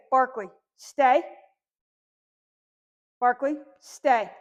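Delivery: loud, slow, firm "Barkley, stay"; slow, loud firm order to a dog; firm command. Shown as a demonstration. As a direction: plant it like a stop sign. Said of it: "in a pretty firm tone".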